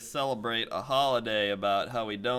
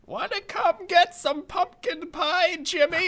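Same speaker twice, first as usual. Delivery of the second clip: put-on high sweet old lady voice, slow; a sugary old grandmother calling; grandma voice; 7 dB louder, slower than usual. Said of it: "Grandma's like".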